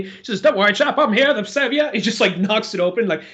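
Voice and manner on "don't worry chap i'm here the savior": a light British gentleman voice, rising high; a hint of an old British gent reassuring a soldier. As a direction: play the proper British rescuer, lightly. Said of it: "so proper, so British, and so gentlemanly".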